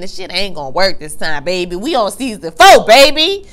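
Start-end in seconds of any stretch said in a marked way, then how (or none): 1.8-3.5 louder, higher shout at the screen; loud taunt. Real, not acted. Viewer taunting Serena about season four, with a loud gleeful shout. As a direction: call her out, gleeful. Calling out the character.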